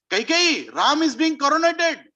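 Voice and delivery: high-pitched urgent cry to the queen; urgent announcement. Acted. Mantra brings the queen news of the coronation, urgent.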